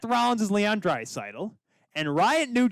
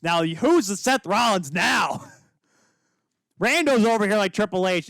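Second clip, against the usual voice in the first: taunting shout; 3 semitones higher, 5 dB louder than usual. Real, not acted. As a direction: call it out to taunt your co-host. Crowing challenge in friendly argument.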